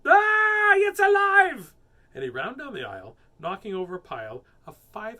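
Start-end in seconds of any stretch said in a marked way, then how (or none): 0.0-1.7 high, full-volume shriek; terrified scream. A narrator's reading. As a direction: scream in alarm as the shocked man. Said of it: "And the man screamed".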